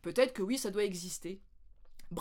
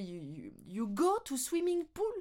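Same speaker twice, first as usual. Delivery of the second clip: slow, higher careful English question; puzzled question; 5 semitones higher, slower than usual. Retold or imitated. Learner checking with her colleague, slow puzzled question. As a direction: ask it haltingly and slowly, mock puzzled. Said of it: "je fais".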